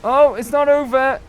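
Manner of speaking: high and loud, caught off guard; alarmed shout. Real, not acted. Gamer realising the fight continues, high loud alarm. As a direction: shout as the danger comes back.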